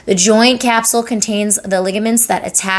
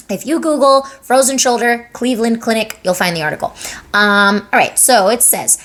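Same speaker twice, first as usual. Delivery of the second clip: ordinary talk, nothing marked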